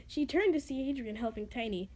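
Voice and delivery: ordinary delivery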